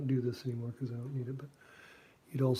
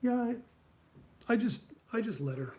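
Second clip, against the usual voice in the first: higher, louder mock Schulz voice; higher mock voice, a little superior; smug Schulz; 9 semitones higher, 3 dB louder than usual. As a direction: deliver the boast after a dramatic pause. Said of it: "and then he goes".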